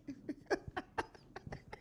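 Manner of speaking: high, wheezing burst of laughter; high breathy laugh. Real, not acted. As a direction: laugh hard, almost silent. Laughing after interruption.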